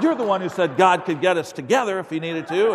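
high, loud accusing comeback; hammed-up marital scolding; mock indignant retort. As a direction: throw it back at your husband, loudly. Acted wife's retort in quarrel.

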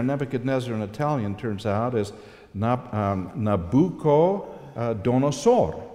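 ordinary delivery

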